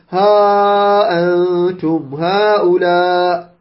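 ها أنتم هؤلاء with the ها and هؤلاء vowels stretched very long and slow; long madd drawn out. Shown as a demonstration. Teacher reciting the verse to show the long madd. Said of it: "ها مد جاد مفصل".

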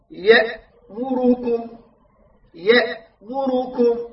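"يأمركم" ببطء مع ضمتين كاملتين على الميم والراء; رتّل "يأمركم" مبيّنًا كل ضمة; حركات تامّة. Shown as a demonstration. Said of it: "هنا عندنا مثلًا قوله تعالى". (تلاوة "يأمركم" متأنية بلا اختلاس)

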